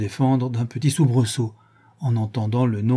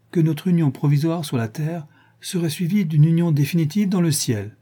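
ordinary delivery, nothing marked

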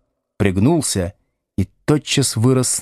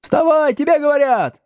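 high-pitched pupils' yell; high, officious classroom cry; helpful shouting. Acted. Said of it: "услужливо закричали несколько голосов".

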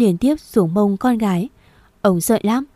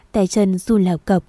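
usual speaking voice

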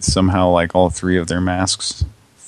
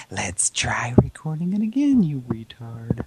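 patronizing; 3 semitones higher, 5 dB quieter than usual. Retold or imitated. Caller mocking the recorded phone prompt, patronizing. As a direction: talk down to them in a mock-helpful voice. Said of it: "So patronizing, like".

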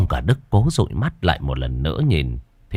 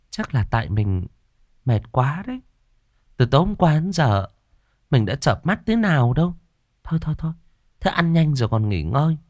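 lightly higher wife, gently coaxing; wife's higher voice, comforting; 7 semitones higher than usual. A narrator's reading. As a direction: soothe him like a worried wife. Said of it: "động viên".

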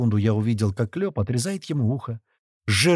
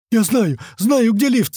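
high, loud desperate cry; panicked wailing; 10 semitones higher, 6 dB louder, slower than usual. A narrator's reading. Tortured captive giving in, terrified wailing. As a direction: wail it out in panic. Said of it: "завопил Толстый".

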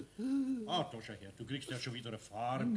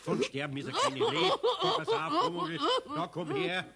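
higher, laughing soothing; laughing reassurance; 5 dB louder than usual. Acted. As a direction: chuckle while calming him down. Eder comforts laughing.

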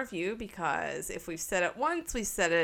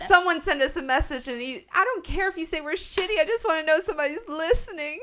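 desperate pleading; 8 semitones higher, 4 dB louder, faster than usual. Real, not acted. Pleading for listener messages.

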